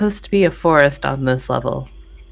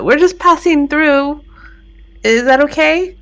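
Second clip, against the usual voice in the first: shouting out; 8 semitones higher than usual. Acted. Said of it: "I'll shout out to him".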